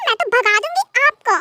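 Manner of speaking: ordinary talk, nothing marked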